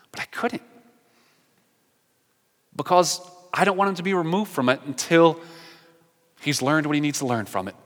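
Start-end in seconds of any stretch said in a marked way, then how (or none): 0.1-4.5 breathless, breaking whisper. Real, not acted. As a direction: barely get the words out, choked up. Emotional memory of praying for brother.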